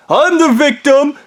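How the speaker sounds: milk the victimhood; whiny victim. Retold or imitated. Ham Man whining to Matt. Repeated claim, escalated.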